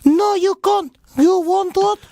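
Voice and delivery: high, broken English maid voice; shrill-free exaggerated foreign maid; mock maid accent. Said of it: "What accents are these?".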